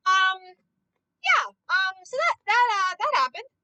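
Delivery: hammed-up teary whimper; mock crying. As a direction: fake a little sob through it. Mock distress after gore scene.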